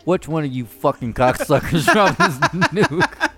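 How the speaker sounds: high, slow, laughing mock rage; mock angry gamer. Teammates cursing whoever dropped the nuke, laughing mock rage. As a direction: whine it through laughter like a furious teammate.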